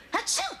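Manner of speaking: a big theatrical achoo; fake sneeze. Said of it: "when you sneezed".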